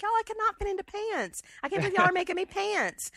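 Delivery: high Savannah protest, whiny; Savannah accent. Retold or imitated. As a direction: plead high in a Southern Savannah voice. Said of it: "She's like".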